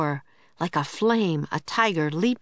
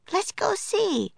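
put-on high, slow girl voice; Annie eager. A narrator's reading. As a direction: coax like an eager little girl. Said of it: "said Annie".

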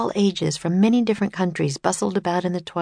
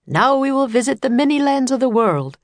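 higher, louder showman announcer; tour guide announcer; 7 semitones higher, 4 dB louder than usual. Acted. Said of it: "said the tour guide".

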